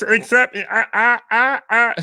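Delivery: high, stuttering cartoon voice; stuttering Wiz. Shown as a demonstration. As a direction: stammer on the I like a flustered Martian. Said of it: "Except he stutters".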